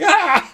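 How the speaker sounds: high, loud mock scream breaking into laughter; retold scream. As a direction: re-enact your startled shriek while laughing. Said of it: "I was laughing so hard at that 'cause I was like".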